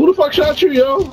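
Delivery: shocked. Real, not acted. Teammate shocked in firefight.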